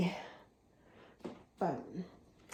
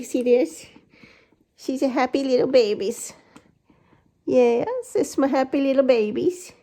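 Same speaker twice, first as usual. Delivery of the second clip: lightly high cooing pet voice; 4 semitones higher, 4 dB louder than usual. Real, not acted. Cooing over the cat again.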